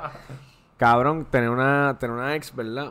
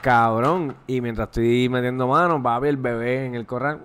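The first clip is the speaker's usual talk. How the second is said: usual speaking voice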